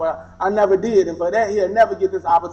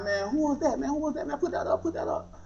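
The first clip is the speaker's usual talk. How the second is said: voices behind door; 7 semitones higher, 9 dB quieter than usual. Retold or imitated. Voices inside the house.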